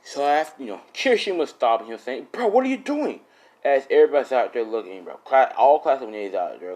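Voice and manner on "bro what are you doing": high-pitched, incredulous call; high mock cry of disbelief; mock alarmed shout. Bystanders to Kirishima as he stops him, mock alarmed shout.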